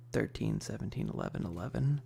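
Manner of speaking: usual speaking voice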